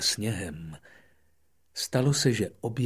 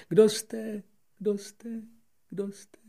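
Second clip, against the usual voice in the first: fading echo; 11 semitones higher, 5 dB louder, slower than usual. Acted. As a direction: let the echo die away. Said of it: "opakovala ozvěna". (slábnoucí ozvěna)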